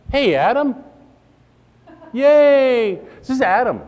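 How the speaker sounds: high, loud, gushing hello; excited greeting. Acted. Presenter greeting Adam at the networking event, gushing and loud. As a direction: greet him like a long-lost friend.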